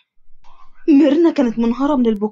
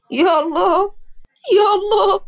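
acted grief, rising on the second call; grieving cry; 6 semitones higher, slower than usual. A narrator's reading. Friends just told Adham has died, grieving cry. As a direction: cry out to God, higher the second time.

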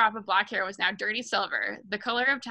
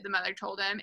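plain reading voice

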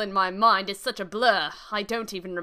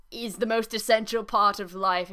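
ordinary delivery

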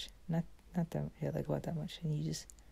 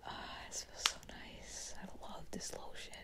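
blissful whisper; 9 dB quieter than usual. Pretend. Whispering to herself while rubbing in lotion, blissful. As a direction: sigh out the pleasure under your breath. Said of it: "and now you're just like".

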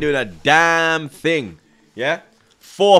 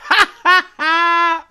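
high burst of gleeful laughter; 11 semitones higher, 3 dB louder than usual. Real, not acted. Fan laughing at rattled City, high glee. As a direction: cackle at the rivals. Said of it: "Oh, that's hilarious".

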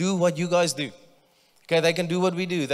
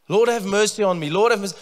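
higher, louder pleading cry; 6 semitones higher, 7 dB louder, faster than usual. Retold or imitated. Desperate mother begging Jesus for help, loud high plea. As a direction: call out loud to the Lord for mercy. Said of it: "so she must have been loud".

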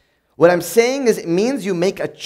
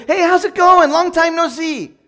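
high and louder, gushing hello; mock-cheerful catch-up voice; overly friendly greeting; 10 semitones higher, 4 dB louder than usual. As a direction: beam at an old friend on the phone. Friendly phone greeting mid-rage.

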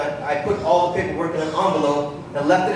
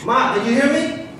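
raised, higher call to get attention; 7 semitones higher than usual. Acted. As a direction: call out to her, insisting. Son calling to distracted mother.

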